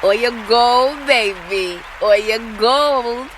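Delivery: slow, drawn-out hype shout to herself; hype cheer. Pretend. Host cheering her own point, slow and loud. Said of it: "Let me get that round of applause".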